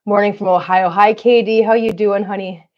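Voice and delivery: ordinary delivery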